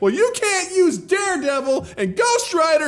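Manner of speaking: high-pitched mock shout; mock heated negotiator. Studio exec refusing the rival side, mock shouting. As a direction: object in a high, outraged voice.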